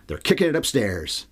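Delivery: ordinary talk, nothing marked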